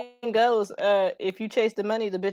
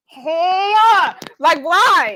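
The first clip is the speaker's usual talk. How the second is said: retold disbelief; 5 semitones higher, 6 dB louder, much slower than usual. Acts out her shocked reaction.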